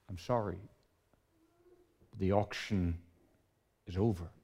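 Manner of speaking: soft, low, grave; solemn auctioneer. Acted. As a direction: lay it down quietly.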